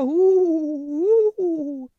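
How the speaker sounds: owl hooting high and loud. Acted. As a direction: hoot like an owl. Owl hoots.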